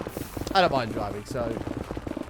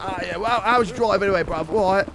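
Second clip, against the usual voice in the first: higher, chirpy London geezer voice; London bruv accent; 5 semitones higher, 3 dB louder than usual. Retold or imitated. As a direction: play the friendly London lad saying bruv. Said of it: "he's like".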